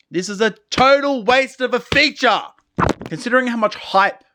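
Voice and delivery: high, loud outraged verdict; indignant rant. Real, not acted. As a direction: vent about the wasted feature. Ranting about the feature.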